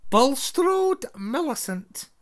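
high, loud stern roll call; calling a name. A narrator's reading. McGonagall calls the next student to the stool. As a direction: call the name out across the hall.

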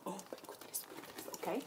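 whisper. Real, not acted. Overwhelmed by the toy.

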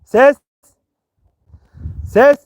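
shouted out to test the echo; loud calls ringing into the shaft; cave echo. Real, not acted. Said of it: "Sesim yankı yapıyor".

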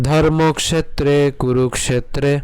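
slow, drawn-out Sanskrit recitation; recitation to repeat. Shown as a demonstration. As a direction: recite for learners to follow, pausing after lines. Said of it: "অবশ্যই পাঠ করবেন".

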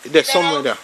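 usual speaking voice

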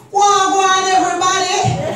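high, loud Jamaican greeting; Jamaican Patois. Shown as a demonstration. Comedian greeting her own crowd, high loud Jamaican Patois. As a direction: holler a hearty Patois greeting.

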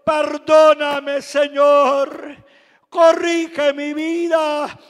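loud, slow, pleading prayer; loud slow tearful plea to God for forgiveness. Retold or imitated. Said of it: "llorando le dije a Dios".

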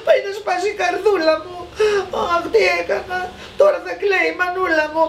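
high, hurried tearful wail; continued crying. A narrator's reading. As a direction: lament like a sobbing little boy. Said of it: "Συνέχισε λοιπόν ο κακομοίρης τα κλάματα". (κλαψιάρικο παιδικό παράπονο)

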